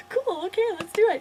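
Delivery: loud, high burst of enthusiasm; acting thrilled to join in; eager agreement. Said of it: "I'd be like".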